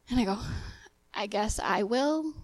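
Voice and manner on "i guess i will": slow, softer grudging consent; reluctant, unenthusiastic. Retold or imitated. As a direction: give in with retold reluctance, dragging it out. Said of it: "don't sound so enthusiastic about it".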